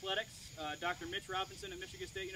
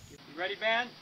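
calling out; 3 semitones higher, 8 dB louder than usual. Real, not acted. Calling out to the band.

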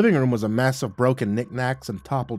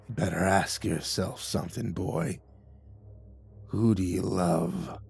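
corpse voice; 4 semitones lower, 7 dB quieter than usual. Acted. Corpse threatening with knife.

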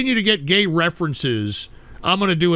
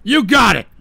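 higher and louder threat; angry yell; 7 semitones higher, 5 dB louder than usual. Real, not acted. Host yelling at callers who keep trolling. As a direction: snap at the whole audience.

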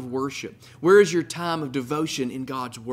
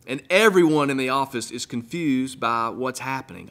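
ordinary delivery

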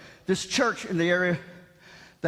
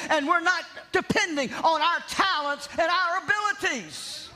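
raised, ringing sermon voice, higher than his chat; preaching; 6 semitones higher than usual. Acted. Pastor preaching to his congregation, in a raised ringing preaching voice. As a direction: preach it out to the church with full conviction.

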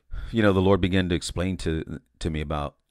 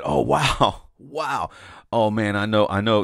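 lifted, louder burst of delighted wonder; excited inner exclamation; 3 semitones higher, 6 dB louder than usual. Retold or imitated. Recalling his vision, he excitedly tells himself he knows. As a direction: gush like you've just guessed the secret.

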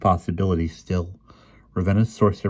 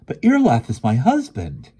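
high, loud, pleading protest; indignant, anguished objection; distressed exclamation; 7 semitones higher, 7 dB louder than usual. A narrator's reading. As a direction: cry out in protest. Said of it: "Ariel exclaimed".

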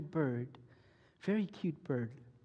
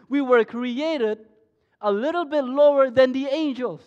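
loud, higher, slow preaching; preaching at full force; 9 semitones higher, 15 dB louder, slower than usual. Acted.

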